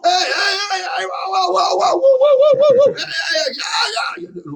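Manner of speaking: high, loud whooping shouts; imitated crowd cheering. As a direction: whoop like castaways spotting a rescue plane. Stranded people cheering at plane.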